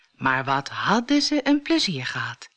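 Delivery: usual speaking voice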